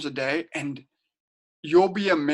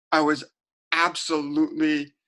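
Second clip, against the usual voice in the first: ordinary delivery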